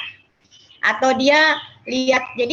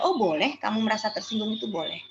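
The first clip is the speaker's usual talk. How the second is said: much softer, accepting tone; adult softly telling the child feeling hurt is allowed; reassuring adult; 7 dB quieter, faster than usual. Retold or imitated. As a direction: gently say it's okay. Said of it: "Nggak apa-apa kalau memang dia".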